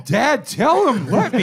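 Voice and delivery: put-on whiny kid. Mocking a whiny kid to Dad.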